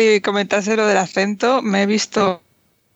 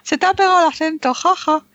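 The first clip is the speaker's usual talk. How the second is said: imitating people teasing with a high "ja, ja"; a high, singsong tease about the accent you picked up; mocking laugh; 8 semitones higher than usual. People back in Madrid mocking a new accent, high teasing "ja, ja". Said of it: "dice la gente".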